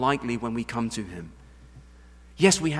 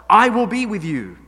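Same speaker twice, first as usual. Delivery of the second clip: booming declaration; 7 semitones higher, 11 dB louder than usual. Acted. Emphatic God quote.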